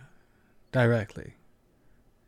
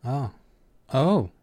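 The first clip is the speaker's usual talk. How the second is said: sudden realization; 3 semitones higher than usual. A narrator's reading. Kiva working out what Aki did, suddenly realizing. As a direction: let it dawn between the two words. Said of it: "Kiva suddenly realized".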